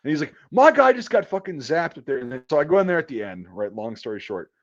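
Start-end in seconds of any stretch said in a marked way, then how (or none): 0.5-2.2 angry yell. Retold or imitated. Retold boss berating gas attendant.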